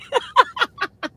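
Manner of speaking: a high, breathy burst of laughter; giggling at being hung up on. Real, not acted. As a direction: let the laugh pop up high. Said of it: "I laughed".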